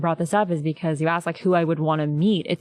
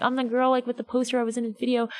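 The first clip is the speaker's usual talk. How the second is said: higher, eager self-introduction; eager explaining; 6 semitones higher, faster than usual. Retold or imitated. Fan pleading to the stage tech, high and eager. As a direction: sell yourself as the girl with the poster.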